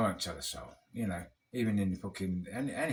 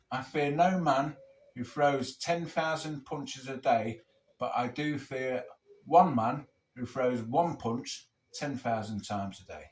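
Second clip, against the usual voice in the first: solemn quote recital; slower than usual. Retold or imitated. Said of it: "this is Bruce Lee quote".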